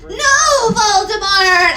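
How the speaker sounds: high, loud laughing shout; shouted back. Acted. Heroine defying Voldemort, high loud laughing shout. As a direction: shout the name back in defiance. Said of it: "I shouted back".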